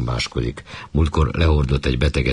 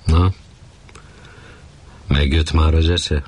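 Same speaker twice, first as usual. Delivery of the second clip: chiding but conciliatory. Acted. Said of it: "korholó, de békülékeny hangon".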